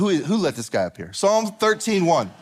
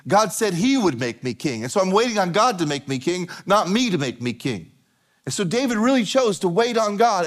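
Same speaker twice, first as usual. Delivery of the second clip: normal talking voice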